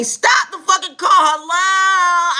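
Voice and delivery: high, loud yelling at the driver; mock yell. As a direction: yell at the driver to stop the car. Yelling at the driver fleeing police.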